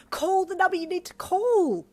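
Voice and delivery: high, loud pleading push; urging. A narrator's reading. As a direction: urge him to call now. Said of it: "you urged him".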